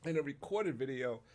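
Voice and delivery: talking through coughs; words broken by a cough; coughing on dust. Real, not acted. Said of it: "It's like I inhaled dust".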